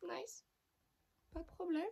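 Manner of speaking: small, very high resigned squeak; mock meek acceptance. User answers the product that insulted her, meek high squeak. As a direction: give in with a tiny, high voice. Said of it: "J'étais en mode".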